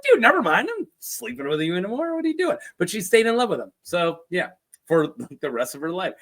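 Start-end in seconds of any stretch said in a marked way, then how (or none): 0.0-2.6 higher, outraged mock Lysa; hurt and offended, pushing him off; indignant mock. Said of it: "I would be like".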